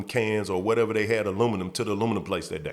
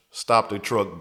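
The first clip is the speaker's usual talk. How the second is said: ordinary talk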